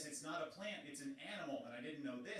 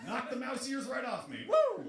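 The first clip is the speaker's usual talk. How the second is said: mock cheer; 11 semitones higher, 9 dB louder, slower than usual. Mock cheerful kid whooping.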